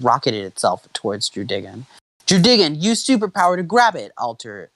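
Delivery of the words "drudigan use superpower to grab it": high, urgent trainer's command; raised, forceful order; instructing in battle. A narrator's reading. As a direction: call the move out to your fighter. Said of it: "Alder instructed".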